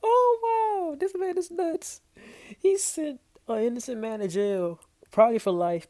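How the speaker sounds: high-pitched laughing disbelief; cracking up. Real, not acted. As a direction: squeal it out through real laughter. Cracking up at the clip.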